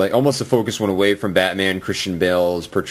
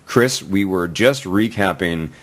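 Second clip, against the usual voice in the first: ordinary talk, nothing marked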